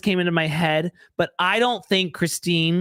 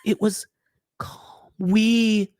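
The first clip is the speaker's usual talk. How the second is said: breathy dramatic whisper; 3 semitones higher than usual. Retold or imitated. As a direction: whisper it like a dreamy confession. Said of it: "I just wanna perform it for you".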